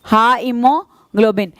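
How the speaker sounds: हा इमोग्लोबिन spelled out slowly in parts; syllable by syllable. Shown as a demonstration. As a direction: break हा इमोग्लोबिन into slow chunks. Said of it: "ऐसे तोड़ तोड़ के याद करोगे". (हा इमोग्लोबिन टुकड़ों में धीरे धीरे)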